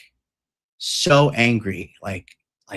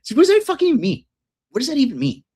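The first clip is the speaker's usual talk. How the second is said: much higher, fast amused disbelief on what does that fucking mean; incredulous echo; 11 semitones higher, much faster than usual. Real, not acted. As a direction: echo the friend's confusion in gleeful disbelief. Echoing friend's baffled reply.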